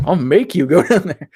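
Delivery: mock threat, laughing through it; mock threatening God.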